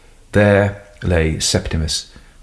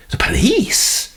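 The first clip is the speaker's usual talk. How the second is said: whispered disbelief, slow; shocked whisper; slower than usual. Acted. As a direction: breathe it in shock.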